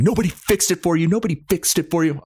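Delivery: high-pitched mock angry cop; mock cop yell. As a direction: snap back at the driver like an irate cop. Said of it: "the cop is just screaming".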